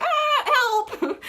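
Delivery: high, laughing fake cry for help; mock weak plea. Mocking the girl texting her friend. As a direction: mimic a feeble helpless plea.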